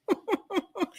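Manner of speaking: soft, high giggle; quiet run of chuckles; laugh. Real, not acted. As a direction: giggle to yourself softly. Breaks off to laugh.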